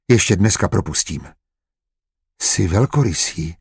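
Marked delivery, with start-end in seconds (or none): 2.4-3.6 carefully conceding a point; mildly cautious admission. A narrator's reading. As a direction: grant it guardedly, a touch hesitant. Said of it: "připustila opatrně".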